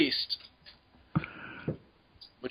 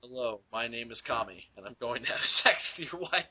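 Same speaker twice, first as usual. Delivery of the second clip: deep sultry voice; 6 semitones lower, 8 dB quieter, faster than usual. Pretend.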